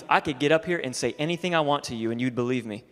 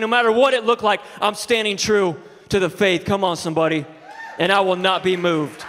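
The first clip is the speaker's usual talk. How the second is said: loud, higher, driving preaching voice; 6 semitones higher, 5 dB louder than usual. Acted. Pastor drives home the truth to his congregation, preaching loud. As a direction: preach it to the whole room.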